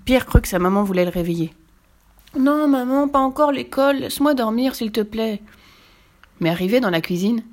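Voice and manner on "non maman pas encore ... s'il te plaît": put-on higher-pitched whining plea of a boy; pleading child. A narrator's reading. Boy to his mother who wakes him, pleading. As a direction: beg to be left asleep.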